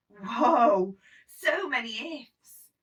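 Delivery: loud, slow, stretched-out whoa; dismayed whoa. Acted. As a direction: let the ifs overwhelm you. Child's dismay at ifs.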